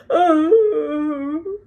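loud high howl like a wounded dog. A narrator's reading. Hagrid howls over the baby like a wounded dog. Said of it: "a howl that sounded like a wounded dog".

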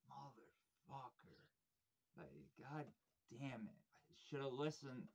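soft, slowed curse under the breath; muttered self-curse. Retold or imitated. Singer to himself as three bands arrive, a soft muttered curse.

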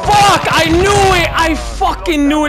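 high, loud furious yelling; furious outburst. Real, not acted. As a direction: explode in frustration. Raging after losing the round.

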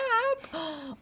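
high, quick intake of breath; audible gasp. Real, not acted. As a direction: gasp in delight at a new follower. Reacts to a viewer's comment.

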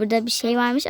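ordinary talk, nothing marked

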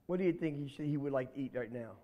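ordinary delivery, nothing marked

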